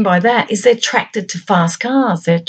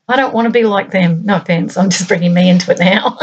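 ordinary talk, nothing marked

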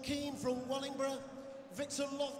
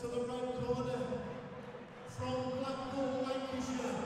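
ring announcer. Real, not acted. Ring announcer introducing the red corner fighter, rising. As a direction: ring announcer calling the name.

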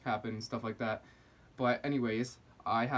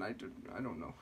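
ordinary delivery

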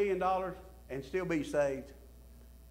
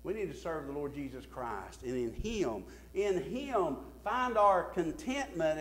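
normal talking voice

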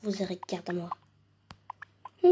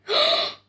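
high gasp of surprise; sharp gasp; 6 dB louder than usual. Pretend. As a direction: gasp in amazement. Sees the tablet.

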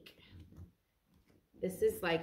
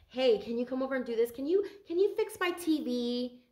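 needy mother; 3 semitones higher than usual. Retold or imitated. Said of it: "Someone's like".

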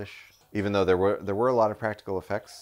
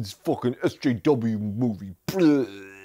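sneering, mocking whiner; mocking complainer; 3 semitones higher, 7 dB louder, slower than usual. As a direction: sneer like an angry fanboy. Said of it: "as the complainers are calling it".

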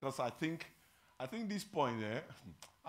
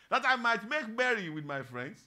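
loud, raised-pitch grievance; angry older son; 8 semitones higher, 8 dB louder than usual. Acted. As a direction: protest to the father, loud and indignant. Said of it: "He was angry".

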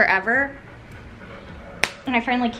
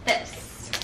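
whispering; 8 dB quieter than usual. Real, not acted. Quiet aside.

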